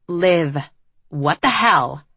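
understated exasperated demand; incensed. A narrator's reading. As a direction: demand an answer, mildly incensed. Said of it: "her incensed face".

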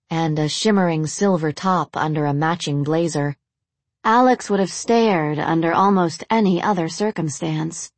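ordinary delivery, nothing marked

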